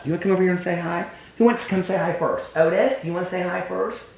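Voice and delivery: high sing-song puppy talk. Real, not acted. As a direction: coo at the puppies. Talking to puppies.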